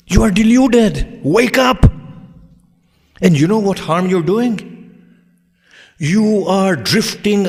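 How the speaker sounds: high, loud preacher's rebuke; full-force preaching. Acted. Preacher warns the conceited of the grave and hell, full-force rebuke. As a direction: thunder a warning at the congregation.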